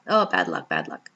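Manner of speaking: a low, lightly voiced mom groaning "bad luck"; imitating mom's sigh. Daughter imitating her mom's pet phrase, low resigned groan. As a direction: play mom shaking her head over bad luck, deep and resigned. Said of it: "my mom likes to say those words".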